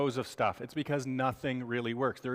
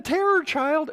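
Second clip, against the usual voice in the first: much higher, louder screech imitating a colicky baby; mock baby scream; 13 semitones higher, 6 dB louder, slower than usual. Lecturer imitating a colicky baby's sudden scream. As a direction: shriek it like a screaming infant. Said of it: "And then".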